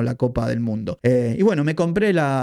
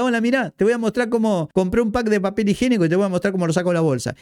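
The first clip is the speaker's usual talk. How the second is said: mock youtuber voice; 6 semitones higher, faster than usual. Mocking unboxing youtuber.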